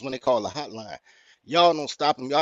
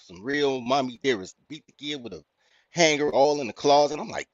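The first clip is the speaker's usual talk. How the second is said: usual speaking voice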